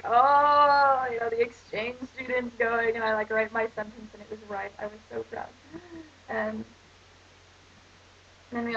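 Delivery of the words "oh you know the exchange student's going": imitating the class cheering the exchange student; hammed-up classmates, louder at the start; mock class chorus. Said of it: "everyone's like".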